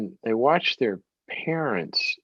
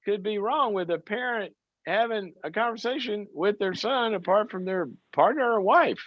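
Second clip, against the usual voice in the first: much higher, disbelieving protest; incredulous; 12 semitones higher than usual. Real, not acted. Host defending mother to caller, incredulous. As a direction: plead how could that be wrong.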